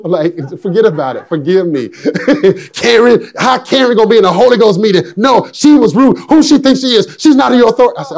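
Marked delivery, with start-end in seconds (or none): 2.7-8.0 higher-pitched, mock-indignant retort; acted-out indignation, high but not shouted; indignant rant. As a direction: imitate a wife fuming mildly, pitched up. Wife's indignant rant retold.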